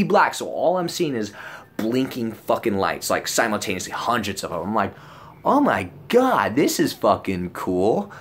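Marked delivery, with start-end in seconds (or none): 5.4-8.1 slow, stretched-out stoner amazement; re-enacted wide-open wonder; awestruck. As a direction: marvel at it, voice leaping up high. Acting out his tripping amazement.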